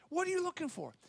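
high and soft, kindly asking; tender, curious inquiry; gentle, inquisitive. Acted. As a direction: lift the question lightly and softly. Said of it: "There's this, this inquisitive".

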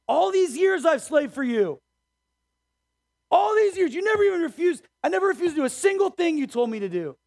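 bitter son pleading his case to his father; older brother shouting at his father about years of work; angry older son. A narrator's reading. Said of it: "was angry and wouldn't go in".

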